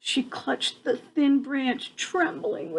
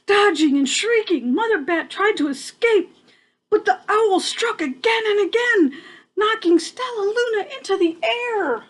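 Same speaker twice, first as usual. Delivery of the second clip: dramatic telling; 7 semitones higher than usual. A narrator's reading. Owl attacks the bats.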